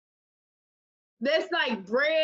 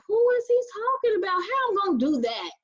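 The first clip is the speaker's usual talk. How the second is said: disbelieving; 7 semitones higher than usual. Retold or imitated. Retelling how she reacted to her brother's prophecy, in high mock disbelief. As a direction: squeak out a stunned who-me reaction. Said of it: "And I'm like".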